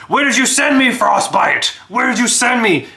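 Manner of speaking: high, loud bewildered dwarf; dwarf calls up from the bottom of the shaft; panicked dwarf shouting. Acted. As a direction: yell up at Frostbite in alarm. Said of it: "and he's just kind of like".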